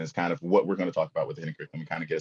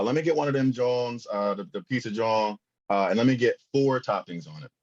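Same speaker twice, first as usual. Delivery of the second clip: put-on a pizza-shop order in a light Philadelphia accent; Philly accent; 4 semitones higher than usual. Customer ordering pizza in a lightly put-on Philly accent. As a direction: order like a Philly local, half joking. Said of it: "I guess my best Philly accent here".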